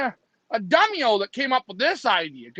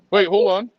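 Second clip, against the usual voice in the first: ordinary talk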